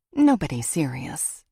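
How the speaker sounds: plain reading voice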